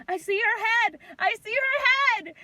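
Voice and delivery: high, loud announcing shout; excited doctor. Retold or imitated. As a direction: call it out like a thrilled doctor. Doctor calling out at birth, retold.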